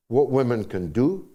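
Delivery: ordinary delivery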